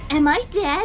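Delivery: ordinary talk, nothing marked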